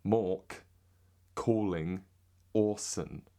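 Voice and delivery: word by word in a British accent. Shown as a demonstration. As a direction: spell out each British vowel slowly. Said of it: "that's me in a British accent".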